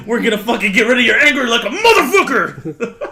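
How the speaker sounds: jokey pitch building to a loud, high yell; laughing mock outburst of fury; mock rage. Counselor promising to cure anger, exploding in mock rage.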